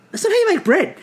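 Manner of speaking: high-pitched protest; incredulous reaction. Retold or imitated. Host watching a baker fry bacon, high-pitched disbelief. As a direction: squeak it in disbelief. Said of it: "I'm watching him going".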